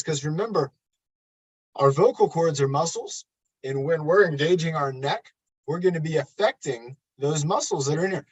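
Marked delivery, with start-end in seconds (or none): none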